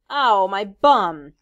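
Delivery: rueful. A narrator's reading. Robert rubbing sore rump.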